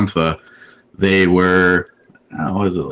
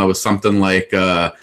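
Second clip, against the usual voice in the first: usual speaking voice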